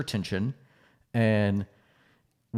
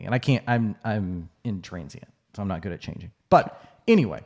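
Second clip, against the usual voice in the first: ordinary talk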